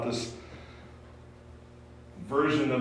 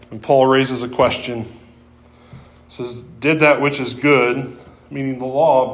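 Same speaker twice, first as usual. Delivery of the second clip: fuller-voiced, louder sermon delivery; preaching; 2 semitones higher, 7 dB louder than usual. Preacher to congregation on the law, full sermon voice. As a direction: proclaim it from the pulpit.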